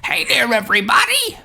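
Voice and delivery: high, excited hello to the viewers; bright cartoon greeting. Shown as a demonstration. Host retesting the mic with a cheery cartoon hello. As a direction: sell the greeting big and high. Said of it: "Let's see if that sounds better".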